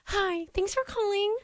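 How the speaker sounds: high, slow phone-greeting singsong; customer service voice. Retold or imitated. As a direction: put on a sugary call-answering voice. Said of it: "It's like a customer service".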